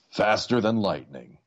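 usual speaking voice

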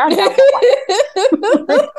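squeaky voice through laughter; giggling high kid voice; laughing, high-pitched. Retold or imitated. Kid's voice, laughing.

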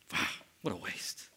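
whispered, soft scornful aside; muttered aside. Pretend. Griping about the ring once out of earshot, whispered. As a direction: mutter it under your breath. Muttering after leaving the wedding.

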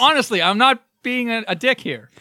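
acted insistence on sincerity; earnest protest. Voicing a texter heading off offence, mock-earnest. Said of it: "They need, like, a, an emoji".

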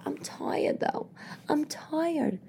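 soft, near-whispered whine; tired protest. Retold or imitated. Tired mother protesting to the nanny, soft whine. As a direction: plead it weakly, barely voiced.

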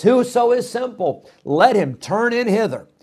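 lightly voiced temptress calling out; foolish woman calling. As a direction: lure passers-by in. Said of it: "And she says".

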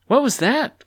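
very high, loud burst of amazement; bursting with curiosity; barely contained excitement. A narrator's reading. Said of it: "Clucky could barely contain herself".

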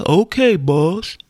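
slow, put-on servile man; Sounder man. Imitating the man in Sounder for the hosts, slow put-on voice. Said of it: "How did he say".

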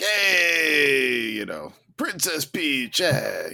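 slow, hammed-up Italian-American Mario accent; mock Italian-American accent. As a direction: drawl it out like a cartoon Italian plumber from New York. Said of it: "that's what I feel like they're gonna go with".

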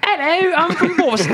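put-on high, hammy Boston accent. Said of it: "Do your Bostonian accent again".

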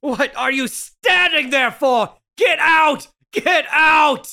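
angry shouting. Acted. Man screams at the neighbor to leave, furious. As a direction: drive him out the door.

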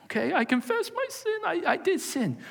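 high, meek confession; confessing girl. Acted. Ashamed woman confessing her sin to Jesus, high. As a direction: pitch way up and admit it.

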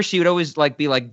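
ordinary talk

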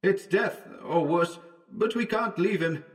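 high, faint far-off calling; distant shout. A narrator's reading. Howard calling to Wells in the fog, distant shouting. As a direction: call out urgently, as from a distance. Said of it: "It was Howard shouting now".

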